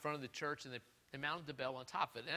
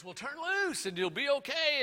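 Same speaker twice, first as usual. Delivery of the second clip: higher, lightly voiced calm voice from the sky; voice from above; 12 semitones higher, 7 dB louder than usual. God answering the hanging man, a light higher voice.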